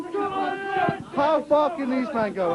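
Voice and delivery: drawn out, slow commentator line; slow, suspenseful sports-commentator question; slow dramatic question. Acted. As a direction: stretch it out for suspense.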